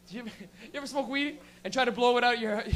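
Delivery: normal talking voice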